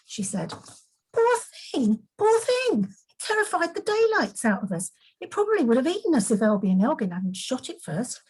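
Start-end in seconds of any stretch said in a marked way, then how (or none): 1.1-8.2 put-on much higher, cheeky boy's indignant outburst; excited exclaim. A narrator's reading. Elrus scoffs at Lizzie pitying the beast, high and excited. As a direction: pitch it way up, scoffing at the pity. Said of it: "Elrus exclaimed".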